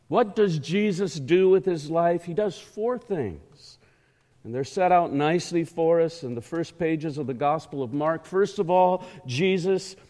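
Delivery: ordinary talk, nothing marked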